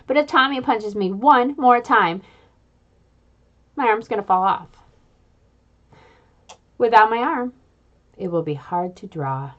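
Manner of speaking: plain reading voice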